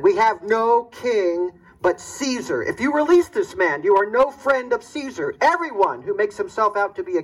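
high, insistent priestly voice; pressing chief priest. Acted. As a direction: corner the governor with Caesar's name.

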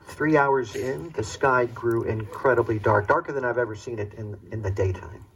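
ordinary talk

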